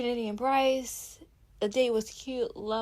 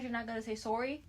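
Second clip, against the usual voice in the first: quick, softer question with a Canadian "sorry"; Canadian accent; 8 dB quieter, faster than usual. Real, not acted. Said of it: "getting my Canadian accent out".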